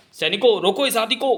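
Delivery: high, urgent call to soldiers; acted urgent command. Ordering soldiers to stop the elephant, high urgent shout.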